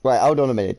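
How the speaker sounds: ordinary talk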